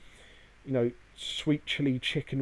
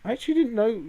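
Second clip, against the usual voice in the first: surprised; 11 semitones higher, 6 dB louder, faster than usual. Real, not acted. Learns a surprising fact.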